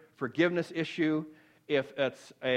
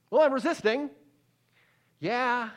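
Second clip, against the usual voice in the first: defensive; 7 semitones higher than usual. Retold or imitated. Mock self-justifying reply.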